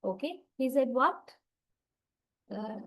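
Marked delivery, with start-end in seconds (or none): 0.6-1.2 "what" rising at the end; rising echo question. Shown as a demonstration. Teacher showing an echo question for something missed, "what" rising. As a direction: ask for a repeat, pitch lifting on "what". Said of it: "Anyway, at the end, rising".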